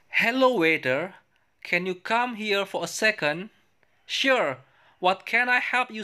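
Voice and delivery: high, slow, careful English reading voice; reading both parts for the class to copy; English dialogue read aloud. Shown as a demonstration. (membaca dialog bahasa Inggris dengan nada tinggi dan pelan)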